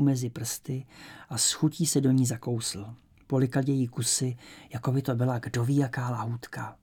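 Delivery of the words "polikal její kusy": ordinary delivery, nothing marked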